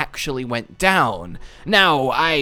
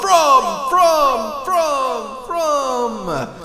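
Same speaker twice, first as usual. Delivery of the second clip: manual echo; 10 semitones higher, much slower than usual. Shown as a demonstration. Vlogger faking an echo with no audio budget, fading repeats. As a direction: fade the word away like an echo. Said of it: "I had to do a manual echo there".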